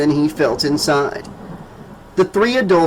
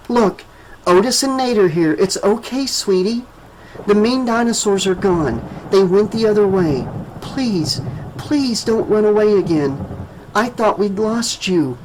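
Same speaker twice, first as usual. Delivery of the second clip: higher, tender reassuring woman; soothing woman; 5 semitones higher than usual. A narrator's reading. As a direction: soothe a scared little girl. Sonya voiced, soothing child.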